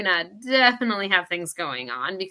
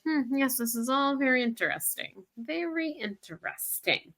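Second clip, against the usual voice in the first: mock intrigued; 6 semitones higher, 6 dB quieter than usual. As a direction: savour it like an amused sleuth, slowing at the end. Acting out own intrigued reaction.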